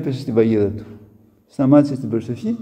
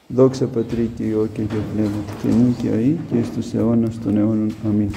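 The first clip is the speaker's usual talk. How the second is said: prayer recitation; 5 semitones lower than usual. Acted.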